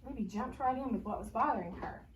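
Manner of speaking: plain reading voice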